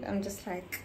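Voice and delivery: ordinary talk, nothing marked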